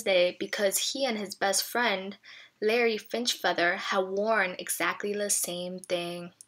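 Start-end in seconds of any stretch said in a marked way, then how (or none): none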